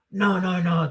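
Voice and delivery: ordinary talk, nothing marked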